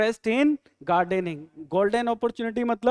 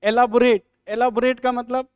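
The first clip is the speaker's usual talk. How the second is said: usual speaking voice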